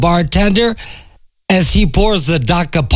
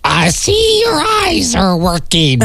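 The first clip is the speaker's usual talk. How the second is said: duck voice; 4 semitones higher than usual. Acted. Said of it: "replies the duck".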